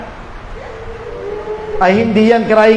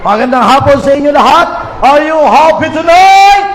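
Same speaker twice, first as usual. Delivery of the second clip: big-mouthed preacher; 4 dB louder than usual. Shown as a demonstration. Preacher showing how a Baptist up front greets everyone, big loud voice. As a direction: belt it out to the whole congregation. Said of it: "ay dapat malaki rin ang bunganga mo".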